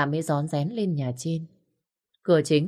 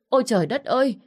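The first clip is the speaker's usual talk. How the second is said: horrified gasp; 8 semitones higher, 4 dB louder, faster than usual. A narrator's reading. Maid sees foam on mouth.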